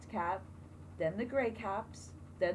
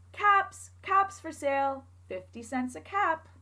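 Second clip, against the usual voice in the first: loud, higher peddler's call; street calling; 6 semitones higher, 6 dB louder than usual. Acted. Peddler calling to buyers on his way to town. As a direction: call out your wares down the street.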